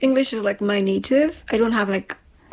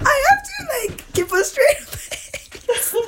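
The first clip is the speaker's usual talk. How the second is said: laughing; slower than usual. Real, not acted. Laughing while telling.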